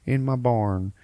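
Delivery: imitating a rambling old coot; old farmer voice. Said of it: "an old guy who's like".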